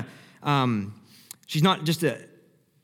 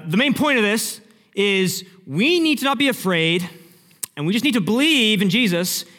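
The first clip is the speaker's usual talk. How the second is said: raised, emphatic sermon point; typical preacher delivery; 5 semitones higher, 4 dB louder than usual. Acted. Wrapping up a sermon on belief, emphatic preaching. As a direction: drive home the main point like a preacher. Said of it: "probably how it's preached".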